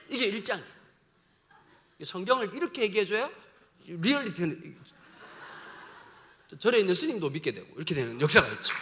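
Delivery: ordinary delivery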